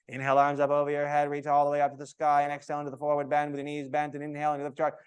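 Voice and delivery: rattling off cues fast; rushed yoga cues. Shown as a demonstration. Teacher mocking chatty instructors, rushing cues. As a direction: hurry through the cues like an annoying teacher.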